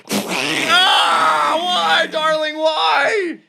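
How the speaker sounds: high crying shout of a betrayed husband; anguished wail. Acted. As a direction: wail out in hammed-up despair. Husband betrayed by squid-wife.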